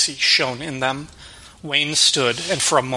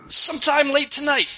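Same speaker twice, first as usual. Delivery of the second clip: higher, demanding thug voice; tough waiter; 13 semitones higher than usual. Acted.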